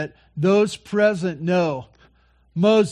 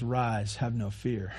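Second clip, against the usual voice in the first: low, soft words of comfort; gently calm someone who is frightened; hushed, tender voice of Jesus; tender reassurance; 4 semitones lower, 11 dB quieter, slower than usual. Acted. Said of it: "this tender moment of Jesus".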